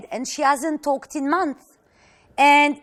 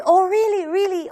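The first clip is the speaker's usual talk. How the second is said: bright, excited surprise, raised pitch; surprised; 6 semitones higher than usual. Retold or imitated. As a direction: gasp out a happy disbelieving really. Blind teen hears of pregnancy.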